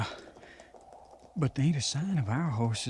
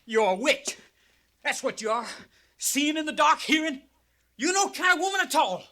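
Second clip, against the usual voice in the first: high, loud, panicked accusation; frightened accusation; 11 semitones higher, 3 dB louder than usual. Acted. Harrison accusing Hattie in the dark, high frightened voice. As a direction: cry it out in rising fear.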